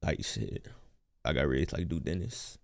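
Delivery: low, rumbling put-on voice; deep voice. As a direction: talk as deep as you can. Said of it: "Make my voice a little deep".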